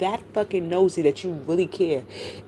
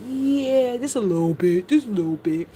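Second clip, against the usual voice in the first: mock agreement; 4 semitones higher, 3 dB louder than usual. Retells wry agreement about oversharing.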